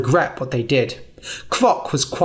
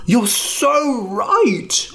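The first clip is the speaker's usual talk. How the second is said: much higher, very slow dragon voice; drawn-out agreement; 8 semitones higher, much slower than usual. Acted. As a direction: savour the agreement.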